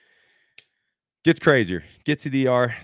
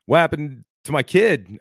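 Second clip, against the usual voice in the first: indignant retort; 6 semitones higher, 6 dB louder than usual. Retold or imitated. Retold confrontation with dog's owner.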